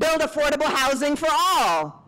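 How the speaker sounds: high call to the crowd; rally the room with a prompt. Shown as a demonstration. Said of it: "calling prompts to the crowd".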